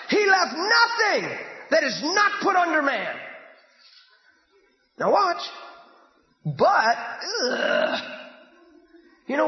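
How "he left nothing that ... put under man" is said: emphatic sermon read; stress on nothing. Real, not acted. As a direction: lean hard on every word. Preacher stressing scripture.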